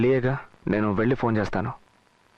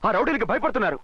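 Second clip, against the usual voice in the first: high-pitched, indignant outburst; defiant rant against being chased away; angry defiance; 11 semitones higher than usual. Acted. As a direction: protest hotly, voice pitched right up. Refuses to flee from rowdies.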